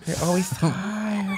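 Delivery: high, slow, soft cooing voice; baby talk to pet. Pretend. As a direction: coo at the sleepy pet. Pretend python is sleepy.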